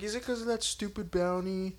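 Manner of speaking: imitating Vash. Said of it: "He's like".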